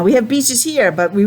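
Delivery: usual speaking voice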